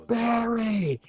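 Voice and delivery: high-pitched, stretched-out name call. Retold or imitated. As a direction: re-enact a fan calling to the stage. Fan calling to star on stage.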